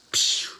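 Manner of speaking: loud, hissing "psh" sound effect; imitated blast. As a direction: make the noise of a lightning strike. Imitates the lightning strike.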